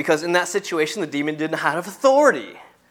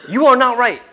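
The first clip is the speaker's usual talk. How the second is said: raised, higher-pitched rebuke; 7 semitones higher, 6 dB louder than usual. Shown as a demonstration. Preacher shows how you rebuke someone, raised firm rebuke. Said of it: "If you rebuke someone, you say".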